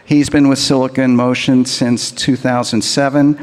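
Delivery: usual speaking voice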